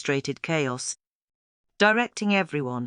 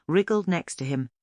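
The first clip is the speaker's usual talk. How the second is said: usual speaking voice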